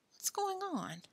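hushed inner question; soft suspicious thought. A narrator's reading. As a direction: wonder it quietly to yourself. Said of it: "thought Billy".

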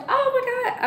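high-pitched thrilled gasp of words; retold excitement. New nurse hearing the job offer, excited. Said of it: "I was so excited on the phone".

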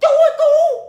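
very high, loud piercing shrieks; acted full-pitch distress cry; screaming for help. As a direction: shriek for rescue, stretching out each call. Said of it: "kêu thất thanh luôn".

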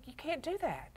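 put-on high, soft child's voice; little kid. Child tells the children's pastor it can't be done, high and soft. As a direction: play a small boy protesting. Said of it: "little kid looking, going".

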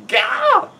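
very high startled cry; sudden squeal of alarm; startled yelp. A narrator's reading.

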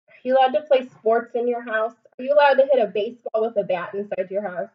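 ordinary delivery

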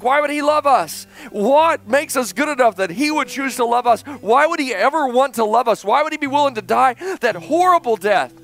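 high, forceful preacher's plea; impassioned preaching. Acted. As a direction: preach it with rising, incredulous urgency.

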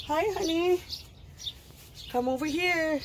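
high coaxing call; pet talk. Real, not acted. Owner calling her pet over, high coaxing voice.

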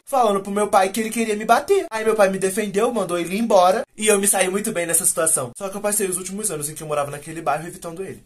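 ordinary delivery

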